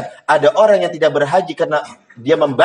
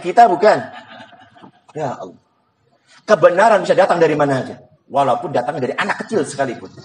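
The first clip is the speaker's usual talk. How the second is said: ordinary talk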